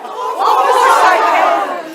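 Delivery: very high, loud, drawn-out hooting impression; Julia Child. Class exercise mimicking a TV cook, comic high-pitched impression. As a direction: shout it slow and high, hammed-up. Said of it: "talk like Julia Child".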